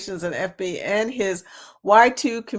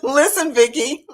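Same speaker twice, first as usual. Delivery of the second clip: high, loud laughing Listen Vicky; mock catchphrase through laughter; imitating the clip; 5 semitones higher, 5 dB louder than usual. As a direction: chime in with the catchphrase, giggling. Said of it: "I gotta play that now".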